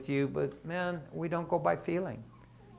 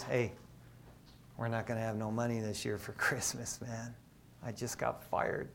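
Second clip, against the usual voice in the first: quiet; 3 semitones lower, 10 dB quieter than usual. Retold or imitated. Telling wife he was fired.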